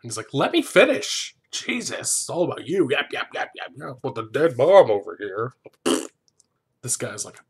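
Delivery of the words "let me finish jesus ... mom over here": high, loud opening outburst, then mocking yapping; mock angry interruption. Host plays the man cutting Claire off, mock angry. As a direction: cut them off in exaggerated exasperation.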